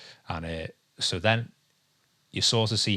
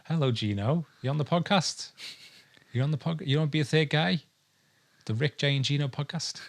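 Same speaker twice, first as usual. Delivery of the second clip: pet voice to the dog; 8 semitones higher than usual. Real, not acted. Talking to the dog Gino, high playful pet voice.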